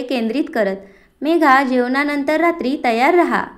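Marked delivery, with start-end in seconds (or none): none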